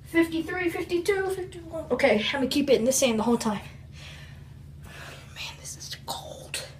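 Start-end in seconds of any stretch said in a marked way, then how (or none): 4.8-6.7 slow pained whisper. Real, not acted. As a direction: breathe it out under the cold. Enduring ice.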